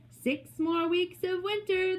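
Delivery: high, stretched announcer's call; president's announcement. A narrator's reading. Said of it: "the president announces".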